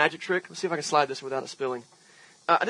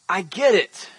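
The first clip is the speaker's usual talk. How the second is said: normal talking voice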